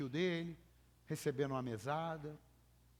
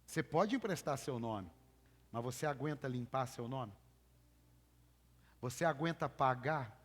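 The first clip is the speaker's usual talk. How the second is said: ordinary talk, nothing marked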